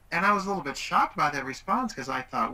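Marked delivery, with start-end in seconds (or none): none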